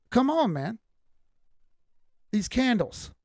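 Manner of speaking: ordinary delivery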